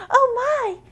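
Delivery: very high, loud gasp of surprise; shocked exclaim. Acted. Cassie startled by the crashing lamp, high and loud. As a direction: shriek it in shock. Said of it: "Cassie exclaimed".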